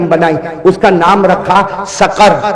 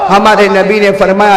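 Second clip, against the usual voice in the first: usual speaking voice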